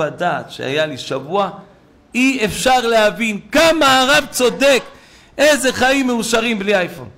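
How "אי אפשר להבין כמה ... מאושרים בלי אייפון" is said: loud, high, stretched-out exclamation; acted enthusiastic testimony. Network worker testifies the rabbi is right, exclaiming.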